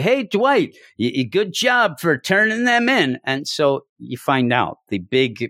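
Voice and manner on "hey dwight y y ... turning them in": high, sing-song fake thanks; sarcastic pat on the back, pitched up; hammed-up cheerful villagers; mock cheery praise. Mocking characters praising Dwight.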